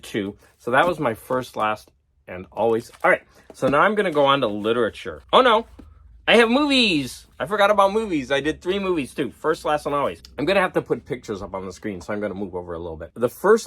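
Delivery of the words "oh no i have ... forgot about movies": high-pitched dismayed surprise; sudden realisation. Real, not acted. Vlogger catching a forgotten movies category, surprised. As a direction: blurt out that you slipped up.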